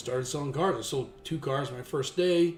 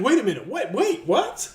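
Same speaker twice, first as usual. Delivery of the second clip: high, loud double take: "wait, what?"; stunned disbelief; 11 semitones higher, 8 dB louder than usual. Retold or imitated. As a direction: sell the shock of being hired without warning. Stunned at being hired on the spot.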